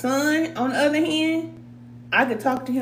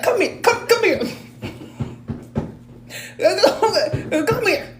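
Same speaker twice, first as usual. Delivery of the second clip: angry parent calling; 9 semitones higher than usual. Retold or imitated. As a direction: call the kid over like a furious parent, half laughing. Angry parent calling child over.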